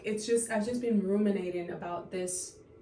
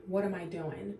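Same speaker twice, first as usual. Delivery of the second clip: ordinary delivery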